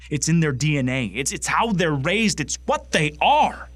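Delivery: hateful conviction boiling over; escalating angry rant. Acted. Acted bigoted rant, escalating.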